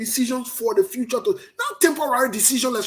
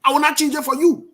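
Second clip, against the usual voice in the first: higher and louder, refusing to back down; defiant; 5 semitones higher, 5 dB louder than usual. Real, not acted. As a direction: plant your feet and refuse. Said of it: "That's how I speak and that's how I communicate".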